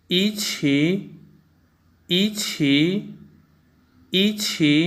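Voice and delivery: ईछी: fall on ई, dip and rise on छी; ईछी in Mandarin tones, fourth tone then third; Chinese yiqi with tone change. Shown as a demonstration. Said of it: "ई का first tone बोलते वक़्त fourth tone में".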